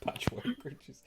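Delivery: amused, laughing echo. Real, not acted. Game master echoes a player's odd phrase, laughing. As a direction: say it softly through a laugh.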